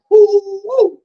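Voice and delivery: very high-pitched woo calls; imitated whooping. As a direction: mimic a player's high whoops. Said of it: "Draylon said".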